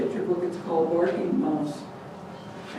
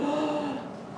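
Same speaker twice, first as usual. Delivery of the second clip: relieved gasp; 8 semitones higher, 7 dB quieter than usual. Acted. Father collapses relieved.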